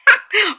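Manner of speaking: loud, high burst of laughter; self-amused laugh. Real, not acted. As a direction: crack up at your own confession. Laughing at own confession about thinking voice.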